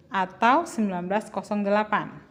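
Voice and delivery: ordinary delivery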